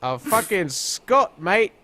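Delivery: slow, lightly put-on British-ish accent; a hint of a fake Scot; mock Scottish accent. Joking about the character being Scottish, in a lightly faked accent. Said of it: "I didn't know she was a Scot".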